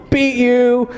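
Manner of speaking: put-on high taunt of a kid; high mock gloat. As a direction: taunt like a winning child. Son gloating upstairs.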